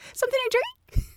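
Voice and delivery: very high, sing-out service question; put-on flight attendant pitch; smiley serving voice. Shown as a demonstration. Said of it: "like a smiley voice".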